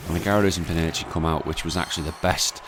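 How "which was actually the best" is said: normal talking voice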